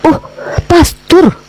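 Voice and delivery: high, incredulous "pastor, uskup, kok kiai?"; mock disbelief. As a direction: scoff as if the question were absurd. Mocking the kiai question. (mengejek dengan nada tak percaya, tinggi)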